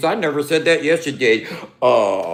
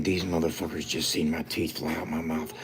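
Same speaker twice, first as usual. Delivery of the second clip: mock inner thought; 9 semitones lower, 9 dB quieter than usual. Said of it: "That's his brain thinking".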